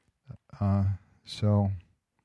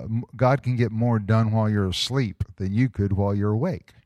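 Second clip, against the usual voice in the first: ordinary talk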